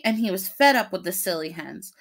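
plain reading voice

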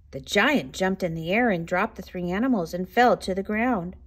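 ordinary delivery, nothing marked

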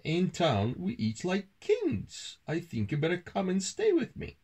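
high-pitched character voice, swinging tune; boastful town mouse. A narrator's reading. Town mouse inviting country mouse to stay, boastful. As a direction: brag to your friend in a high voice. Toby invites his friend.

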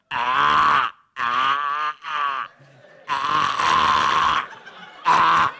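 repeated low, held "ah"; vocal warm-up cries. Acted. Performer tests the voice before the show, low held cries. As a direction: push out rough, low warm-up notes.

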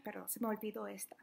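quick whisper, a slip admitted; whispered aside. Real, not acted. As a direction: whisper it fast to yourself.

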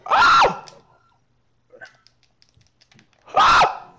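very high piercing scream; terrified scream. Real, not acted. Screams as her character nearly falls to death. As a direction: scream as if about to die. Almost died in game.

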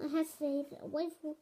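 usual speaking voice

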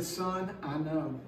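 soft, low reassurance of a father; God answering his son, softly and tenderly. Retold or imitated. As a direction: comfort the son gently and quietly. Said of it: "I think God said".

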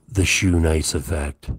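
loud proud proclamation; booming announcement. Acted. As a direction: boom it like a title card. Announcing his own catchphrase loudly.

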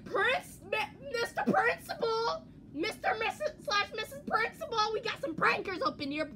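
high, loud, urgent call for the principal; tattling prankee. Acted. Pretending to report pranksters to the principal, high loud mock tattling. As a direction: yell it out in a high, hammed-up voice. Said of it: "if I was part of this, be like".

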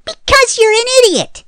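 high, loud furious yell; angry yell. Acted. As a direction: scream the insult at him. Fairy yells at hero.

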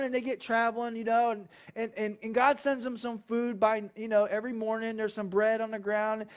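ordinary delivery

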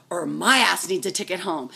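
ordinary talk, nothing marked

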